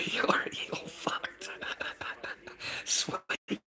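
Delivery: hushed giggling taunt, slowed right down; laughing whisper. Real, not acted. Streamer giggles a whispered taunt at Sweden.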